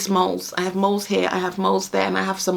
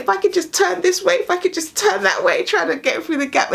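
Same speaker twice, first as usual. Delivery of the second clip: straining; 11 semitones higher than usual. Retold or imitated. Squeezing through gap.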